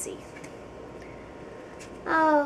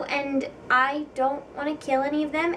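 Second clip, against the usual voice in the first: normal talking voice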